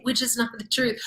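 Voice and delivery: usual speaking voice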